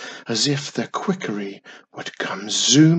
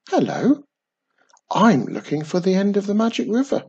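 put-on bright, eager young witch, pitched higher and quick; chirpy little witch asking the way; lightly voiced keen young witch; 8 semitones higher, 3 dB louder, faster than usual.

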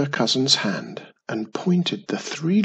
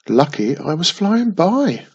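usual speaking voice

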